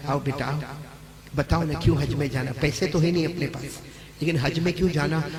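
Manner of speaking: mother, softened, coaxes her son; tender mother. Acted. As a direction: call the son gently to your side. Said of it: "थोड़ी देर के बाद माँ को प्यार आया".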